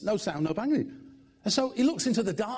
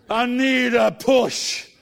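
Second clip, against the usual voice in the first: put-on slow, loud slurred drunk voice; drawn-out tipsy plea; drunk man; 4 semitones higher, 8 dB louder, much slower than usual. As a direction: play the drunk at the door, drawn out.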